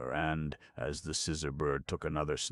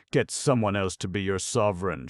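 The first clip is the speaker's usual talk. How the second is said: high and loud outcry; crying out; 6 semitones higher, 8 dB louder than usual. A narrator's reading. To the scrappers, loud defiant cry. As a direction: fling it back at them. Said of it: "she cried".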